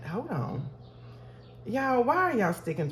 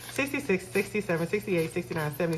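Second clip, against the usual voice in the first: ordinary talk, nothing marked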